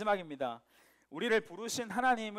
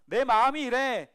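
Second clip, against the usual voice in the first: much louder, slow, God calling Jonah; pleading call; 5 semitones higher, 9 dB louder, slower than usual. Acted. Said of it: "말씀하시는 거란". (간절하게 부르는 목소리)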